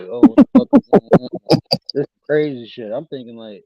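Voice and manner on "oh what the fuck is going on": high, loud startled shout; shocked yell. Retold or imitated. Woken by the streamer, shouting in shock. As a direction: burst out in shock, waking up.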